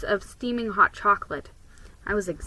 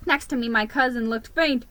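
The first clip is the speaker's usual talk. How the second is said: plain reading voice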